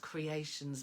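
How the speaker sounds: usual speaking voice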